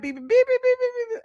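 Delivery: very high, rapid beeps; imitated phone beeping. Streamer retells her phone going off, imitated high beeps. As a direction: mimic a phone's alerts in a squeaky high pitch. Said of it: "my phone started beeping".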